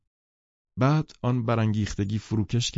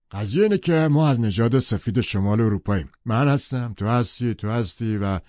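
higher, louder blustering man; Tom's bragging voice; 3 semitones higher, 4 dB louder than usual. Acted. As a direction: boast it like a proud bully. Tom voiced in read novel. (صدای بلند و پرمدعای تام)

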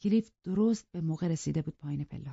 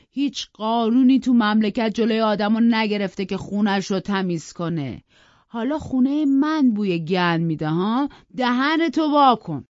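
loud, raised raging threats; furious rant; 4 semitones higher, 6 dB louder than usual. Acted. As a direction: threaten them all in a drunken fury. Hindley forcing knife in mouth. (فریاد خشمگین پدر مست رو به همه)

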